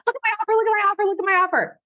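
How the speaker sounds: high-pitched frantic mock scream. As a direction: shout it over a screaming stadium crowd. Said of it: "everybody's screaming at the top of their lungs".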